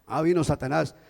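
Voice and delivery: usual speaking voice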